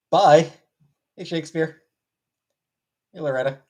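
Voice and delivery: ordinary talk, nothing marked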